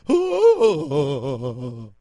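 loud ghostly wail again; ghost scream. Retold or imitated. Imitating his own scream over a pigeon, loud ghostly wail. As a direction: another drawn-out spooky shriek. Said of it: "a ghost coming noise".

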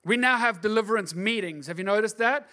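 loud, high preaching that keeps building; full-force preaching. Acted. Preacher rousing the congregation, loud and high. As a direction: preach it out to the crowd.

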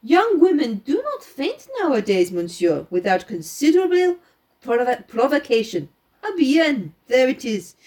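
lightly voiced French-accented detective; Poirot. Poirot laying out the girl's movements for his companion, lightly French-accented. Reads Poirot's explanation aloud.

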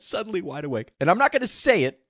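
ordinary talk

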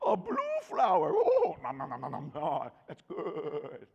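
high, silly grazing sheep voice; happy sheep. Pretend. Preacher voicing a sheep to congregation, high silly munching. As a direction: play a greedy little sheep munching flowers. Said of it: "and you're like".

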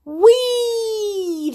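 high, loud whoop of joy; gleeful shout. Acted. Both friends sliding downhill, gleeful shout. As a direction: whoop down the hill. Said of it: "they shouted together".

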